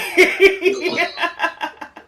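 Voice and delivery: real high burst of laughter; bright laughing burst. Real, not acted. Laugh about poor podcasts.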